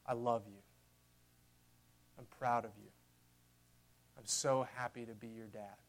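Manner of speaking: hushed, loving words to a son; tender father. Acted. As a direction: speak it softly, like a proud dad. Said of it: "the Father looks down on him and says".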